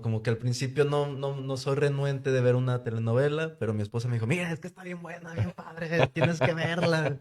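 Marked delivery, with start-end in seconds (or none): 4.3-7.2 higher, quick, gushing wife voice; excited wife. Retold or imitated. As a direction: imitate his wife raving about the show. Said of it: "y así bien emocionada".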